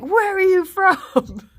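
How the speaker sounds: high, loud, drawn-out demand; mock demanding yell. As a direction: imitate a pursuer calling out, slowly. Man chasing her demands origin.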